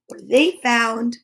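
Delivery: ordinary delivery, nothing marked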